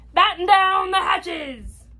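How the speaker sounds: high, slow storm order; pirate shout. A narrator's reading. Captain orders crew in the storm, high slow shout. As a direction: shout each word over the storm. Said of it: "Braidbeard shouted".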